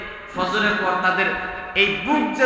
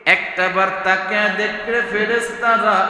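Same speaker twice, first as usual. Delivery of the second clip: sung, swelling sermon cadence; preacher voicing God calling the angels; preaching at full force; 3 semitones higher, 6 dB louder than usual. Preacher to congregation, singing out the words in full force.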